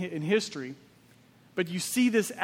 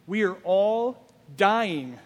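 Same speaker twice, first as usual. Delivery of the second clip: slow solemn statement; 3 dB louder, much slower than usual. Real, not acted. Preacher's solemn key statement.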